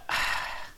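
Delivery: audible sigh; real sigh between remarks. Real, not acted. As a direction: let out a breathy sigh. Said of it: "I'm sure I'm sighing a lot".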